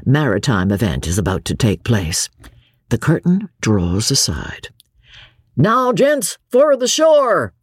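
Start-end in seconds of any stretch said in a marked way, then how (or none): 5.6-7.5 high, loud, slow call to the passengers; rally them for the shore, loud and high; loud high sailor's call; loud exclamation. Acted. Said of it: "a voice exclaims".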